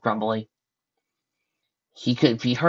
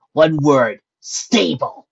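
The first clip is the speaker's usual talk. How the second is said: angry growl; 4 semitones higher, 9 dB louder, much slower than usual. Acted. Lucy growling the warning to Carter, angry. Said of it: "she growled angrily".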